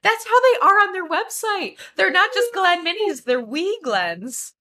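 high, squealing gush over something cute. Real, not acted. Bartender gushing to co-host about tiny bottles. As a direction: gush like it's the most precious thing.

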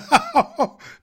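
loud, high jolly belly laugh; big Santa laugh. Acted. Santa laughing loud at the girl's wish. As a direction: let out a huge hearty laugh. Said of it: "big and laughing loud".

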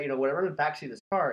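normal talking voice

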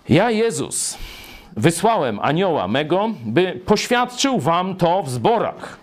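high, emphatic proclamation; proclaiming scripture. Acted. Preacher declaring Jesus' words to the churches, proclaiming.

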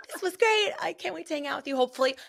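high, mock-gushy phone chat; gushing. Host imagines calling her friend after the show, playfully gushing. Acting out their planned phone call.

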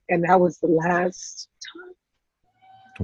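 slow, broken whisper of real grief. Real, not acted. Daughter telling the host of her mother's final moment, whispered. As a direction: let it fall to a whisper as it sinks in.

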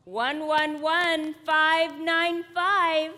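loud, slow number call; slow loud announcement. Acted. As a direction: announce each digit slowly and loud. Repeats number slowly, louder.

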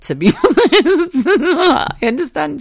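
high-pitched, talking through real laughter; helpless laugh stretching out the words. Real, not acted. Laughing at her own rant.

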